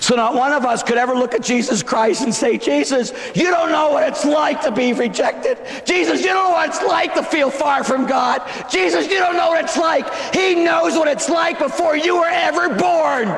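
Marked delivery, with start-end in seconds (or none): 2.6-10.1 shouted mock complaint. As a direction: hurl the complaint at Jesus. Voicing complaining believer.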